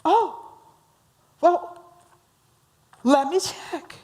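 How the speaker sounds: high, small hurt reply; offended. Acted. Joe the romantic answers Bob's demand, offended. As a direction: deflate from a high "oh", mock wounded. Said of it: "Now, Joe's all offended".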